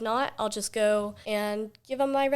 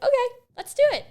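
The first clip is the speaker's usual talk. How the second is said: much higher and louder, fast burst of eagerness; eager yes; 9 dB louder, faster than usual. Retold or imitated. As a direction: jump at the idea, quick and bright. Eager self quoted agreeing.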